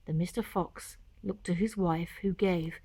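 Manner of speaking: soft, hushed closing lines; soft whispered ending. A narrator's reading. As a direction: let the story fade gently.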